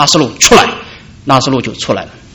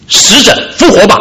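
1 commanding call; 5 semitones higher than usual. Acted. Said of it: "那种呼叫的声音就是一种全能的命令".

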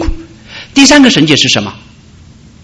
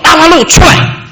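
commanding call; 12 semitones higher, slower than usual. Acted. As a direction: call the dead man out with full authority. Jesus calls at the tomb.

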